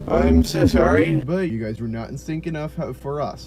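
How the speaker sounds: the announcer blaming the students again; overhead announcement. Retold or imitated. Staff telling students over the speakers they were out of sync, mock PA voice. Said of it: "they announced once again".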